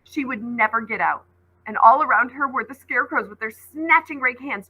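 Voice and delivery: ordinary delivery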